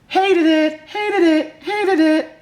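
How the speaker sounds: high, loud chant of hated it; mock tantrum over a hated book, loud and high; loud mock rant. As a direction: hammer each repeat like a tantrum. Ranting about disliked book.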